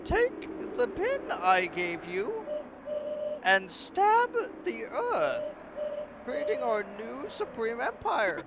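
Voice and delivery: a scheming mother-queen sweet-talking her daughter; coaxing robot queen. Acted.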